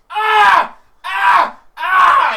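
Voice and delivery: very loud, high screams of pain. Acted. Thug with dog on his leg, screaming loud and high.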